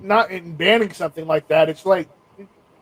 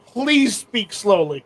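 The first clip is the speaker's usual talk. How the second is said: slow, spaced-out request; slow plea; much slower than usual. Retold or imitated. Asking Cajun speakers to slow down, slow careful plea. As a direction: spell out the plea slowly. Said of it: "guys, slow down".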